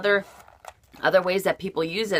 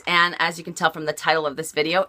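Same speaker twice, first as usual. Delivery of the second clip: usual speaking voice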